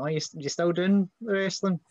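patronising, mocking high question; condescending. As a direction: sneer it down at him. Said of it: "it's almost kinda condescending".

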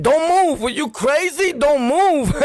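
high, scolding parent imitation; mock parental screaming. Parent ordering a kid mid-whipping, mock screaming. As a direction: scold high and hard, hammed up. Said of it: "they start screaming".

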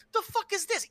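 very high-pitched baffled reaction; bewildered disbelief. Retold or imitated. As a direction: act out waking up totally confused. Imagining waking up to that.

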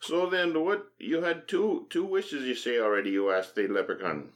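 high Irish leprechaun voice; high chirpy leprechaun. Pretend.

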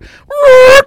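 high, loud screeching zoom noise; piercing mock screech; imitated screech. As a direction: mimic a sudden screeching arrival. Imitates the man roaring back up.

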